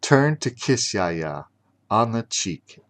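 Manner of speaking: ordinary delivery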